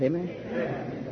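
normal talking voice

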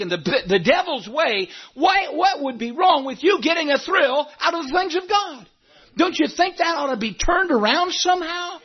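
rising into a shouted challenge; fiery preaching. Acted. Pastor asking why God's things bring no thrill, fiery preaching. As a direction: preach the question right at them.

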